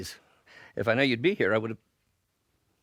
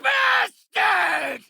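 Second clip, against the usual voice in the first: very high, drawn-out furious shout; enraged curse; 4 dB louder than usual. Acted. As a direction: scream the curse at your enemy. Villain curses his enemy.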